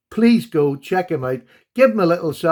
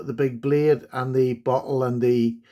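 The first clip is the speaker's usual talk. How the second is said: ordinary delivery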